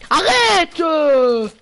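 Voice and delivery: high, loud whining protest; whiny yell. Real, not acted. Yelling at a teammate who keeps hitting him, whiny and loud. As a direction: yell at him to stop, dragging it out.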